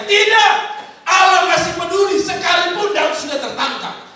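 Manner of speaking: a loud, high "Tidak" that cuts the doubt off; preacher shouting the answer to the doubting congregation; shouted denial. Real, not acted. Preacher to congregation, a loud high rebuttal.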